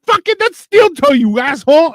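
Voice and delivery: high, loud, pained shout; a kid shouting at his brother; outraged yell. Retold or imitated. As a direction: yell in outrage at being kicked with a steel toe. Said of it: "I'm like".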